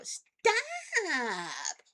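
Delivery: long, drawn-out high whine of protest; put-upon wife's elongated yelp; exasperated stop. Retold or imitated. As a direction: stretch it out in annoyed protest, high and wailing. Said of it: "I'd look at him and I'd go".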